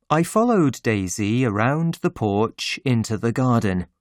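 usual speaking voice

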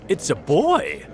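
loud, high exclamation; astonished cry. A narrator's reading. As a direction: call out the amazing sight. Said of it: "cried the firefighter".

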